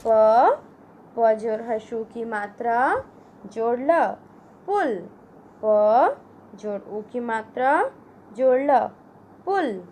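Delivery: put-on slow, spelled-out syllables for children; syllable drill. Shown as a demonstration. Teacher sounding out gulab for children, slow and clear. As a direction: build the word sound by sound.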